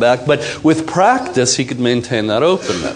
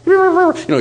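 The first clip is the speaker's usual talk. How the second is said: high, put-on woman's voice; mock woman's voice. The woman protesting to her man, high mock voice. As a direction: play her, pitched way up.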